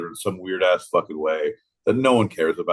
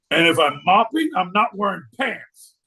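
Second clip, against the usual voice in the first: high-pitched declaration; 8 semitones higher, 3 dB louder than usual. Acted. Acting out cleaning business pitch.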